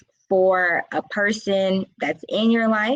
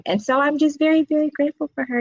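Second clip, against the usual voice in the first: on the verge of tears; 8 semitones higher, much faster than usual. Real, not acted. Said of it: "'Cause I'm about to cry".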